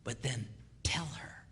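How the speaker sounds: soft unvoiced secret; confiding whisper to the men. Real, not acted. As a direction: let them in on the trick quietly. Whispered advice aside.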